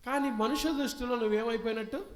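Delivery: high, loud challenge to the crowd; booming preacher question; loud rhetorical question. Acted.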